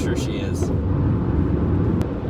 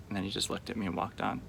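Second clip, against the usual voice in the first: ordinary delivery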